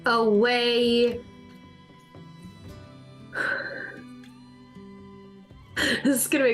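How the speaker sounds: high squeaky character voice. Acted. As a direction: squeak it out like a tiny critter.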